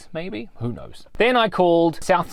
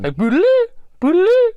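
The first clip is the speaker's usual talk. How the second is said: rising, much higher, louder "boodaloo" beep; imitated beeping; 6 dB louder, faster than usual. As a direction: mimic the tool beeping over the chat. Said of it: "that sound".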